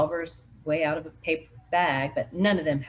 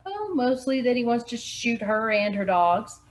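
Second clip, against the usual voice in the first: local man voice; 6 semitones higher, 4 dB louder than usual. Acted. Local Rip voiced by narrator.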